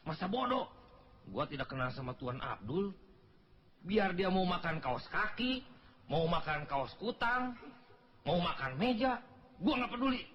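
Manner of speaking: comic indignation, pitch leaping high at the ends; exasperated rant. Acted. Exasperated rant at pointless secret. (omelan kesal dengan nada melonjak)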